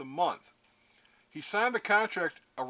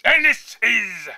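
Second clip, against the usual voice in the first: loud, slow, stretched-out impression; Nick Diaz; 3 semitones higher, 11 dB louder, much slower than usual. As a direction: drag the words out in a put-on fighter's voice. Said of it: "Nick Diaz talks like this anyway".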